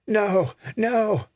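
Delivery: high-pitched cry, not full volume; mock scream. A narrator's reading. Injured woman being lifted to her feet, acted scream. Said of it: "She screamed out".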